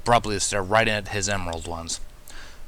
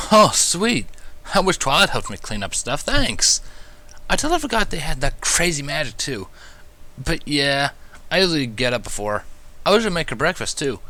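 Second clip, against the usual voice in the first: Spike, cheerful; 7 semitones higher, 3 dB louder than usual. A narrator's reading. Spike voiced in story.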